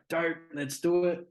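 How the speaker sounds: high, loud, excited yes; eager agreement. Retold or imitated. Cousin eagerly agrees to the song.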